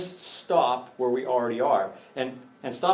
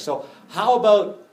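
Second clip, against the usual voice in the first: bargaining disciple; 7 semitones higher, 8 dB louder than usual. Acted.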